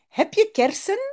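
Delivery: very high, odd squawky parrot question. Acted. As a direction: ask it like a parrot. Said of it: "vraagt de papegaai". (voix de perroquet très aiguë qui demande)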